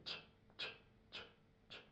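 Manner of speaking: "tche" as a whispered hiss; ch sound. Shown as a demonstration. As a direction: push out just the sound of "tche". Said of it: "c'est tche".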